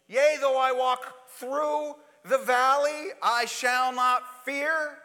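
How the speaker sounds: slow, drawn-out solemn psalm line; slow psalm recitation. Acted. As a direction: stretch every word like a vow.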